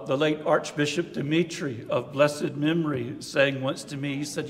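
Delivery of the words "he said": ordinary talk, nothing marked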